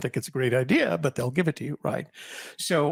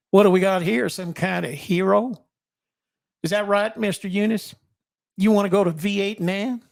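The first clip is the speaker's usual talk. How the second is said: Southern sergeant; 5 semitones higher, 4 dB louder than usual. Retold or imitated. Drill sergeant taunting recruit at the back, loud Southern accent. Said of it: "He had, he had a Southern accent".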